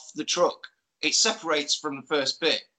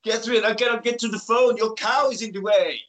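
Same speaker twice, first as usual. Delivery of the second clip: put-on higher-pitched comic Belgian accent; high, stilted mock Belgian accent; Jean-Claude Van Damme accent; 5 semitones higher than usual. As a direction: ham up a Belgian action star's accent. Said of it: "That's your award-winning J C V D accent".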